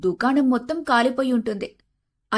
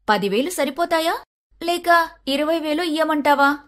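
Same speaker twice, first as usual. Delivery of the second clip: higher, sweetly sarcastic offer; teasing wife; mock generous; 5 semitones higher than usual. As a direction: bait the greedy auntie. Sandhya mocks loan request.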